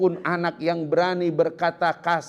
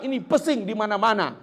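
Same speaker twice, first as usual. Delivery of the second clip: fast annoyed complaint; 8 semitones higher, faster than usual. Retold or imitated. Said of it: "ibunya yang dia salahkan".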